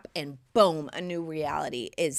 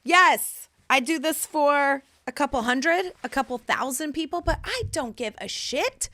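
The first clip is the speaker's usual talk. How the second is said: defiant excitement; 8 semitones higher, 6 dB louder than usual. Real, not acted. Defiant shout after song.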